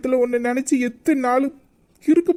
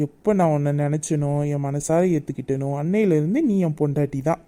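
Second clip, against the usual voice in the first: tender vow; 9 semitones lower than usual. Acted. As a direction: say it gently to your beloved. Tender love declarations.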